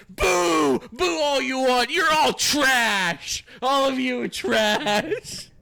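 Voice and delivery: loud, slow heel taunt; sneering wrestling villain. Pretend. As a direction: shout down the booing crowd. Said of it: "start talking shit on the crowd".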